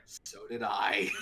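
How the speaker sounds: low, slow, laughing through it; laughing reply. Retold or imitated. Steve answering his tall date, laughing low and slow. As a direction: chuckle it out at yourself. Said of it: "and he's like".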